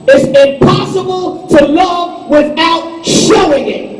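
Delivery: slow, loud preaching cadence, each word driven home. Acted. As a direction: hammer the truth to the congregation. Preaching at full force.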